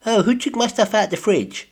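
whiny housemate voice. Retold or imitated.

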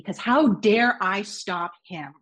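ordinary delivery

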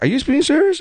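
high, incredulous rising question; genuinely stunned question; asking the shop girl in disbelief. Retold or imitated.

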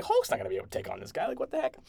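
replaying her own reaction to the fight scene, mock incredulous; mock disbelief. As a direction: rattle it off, then squeak in disbelief. Retelling own disbelief watching.

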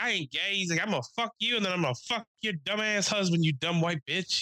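high, hammed-up insulting rant; mock trash talk. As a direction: keep roasting her in a put-on voice. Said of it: "Just trashing her".

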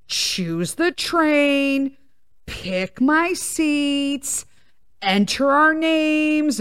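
slow, dragged-out listing of steps; tedious slow recital. Retold or imitated. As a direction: drag each step out wearily. Retracing tedious booking steps.

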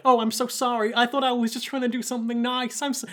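put-on high boyish apology; high-pitched upset kid; Jacuzzi. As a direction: apologise in a small child's voice, mock tearful. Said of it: "he starts crying 'cause he's Jacuzzi".

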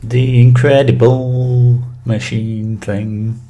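slow, drawn-out bad Scottish accent; a hammy stab at Scottish. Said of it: "That was my Scottish accent".

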